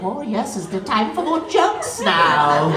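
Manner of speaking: creepy goblin voice, slow at the end; comedy goblin. Acted. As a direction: drawl it like a weird goblin crawling out. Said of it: "like a weird fucking comedy goblin".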